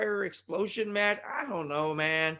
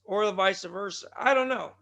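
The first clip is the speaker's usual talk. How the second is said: ordinary delivery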